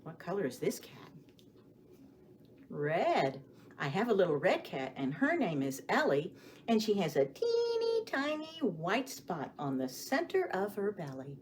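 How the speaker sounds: ordinary talk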